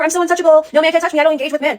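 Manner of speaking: high, loud, haughty mock boast; high and loud, sneering caricature of aloofness; mocking untouchable woman. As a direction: lampoon a woman posing above everyone. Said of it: "women putting themselves up in their tower".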